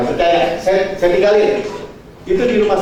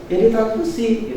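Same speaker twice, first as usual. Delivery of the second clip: offhand doctor; 4 semitones higher, slower than usual. Retold or imitated. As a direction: toss off "ditransfusi" lightly but unhurried. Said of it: "Enteng aja transfusi". ("ditransfusi" dengan nada enteng)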